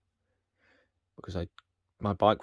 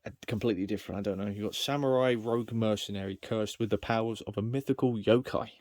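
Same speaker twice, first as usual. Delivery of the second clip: ordinary talk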